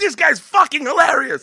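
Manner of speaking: high, loud raving; delighted crowd reaction. Retold or imitated. Gangsters about the clowning student, loud delighted raving. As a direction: exclaim it as a thrilled onlooker. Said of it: "And they're like".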